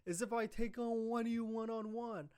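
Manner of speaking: high-pitched mock amusement; sarcastic amusement. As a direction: imitate someone pretending to find it hilarious. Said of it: "he starts sarcastically laughing".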